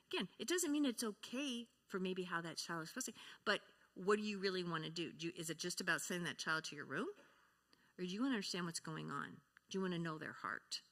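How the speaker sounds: usual speaking voice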